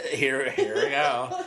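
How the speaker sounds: slow, teasing "here we go"; mocking drawl. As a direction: drag it out mockingly.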